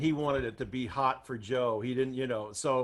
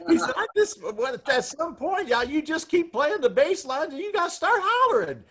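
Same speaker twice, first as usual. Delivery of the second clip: higher and louder, climbing into falsetto; Ray's pitch climbing higher and higher; rising high voice; 11 semitones higher, 5 dB louder than usual. Retold or imitated. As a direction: imitate Ray's voice going up and up. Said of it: "His voice kept going up in pitch".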